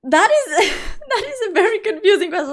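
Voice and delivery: high-pitched, laughing through the words; amused, laughing. Real, not acted. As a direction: let a giggle break into the sentence. Amused by a confusing chat question.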